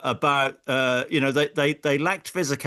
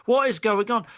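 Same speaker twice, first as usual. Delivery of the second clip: mock baffled fan; 8 semitones higher than usual. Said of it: "I can imagine Spurs fans thinking".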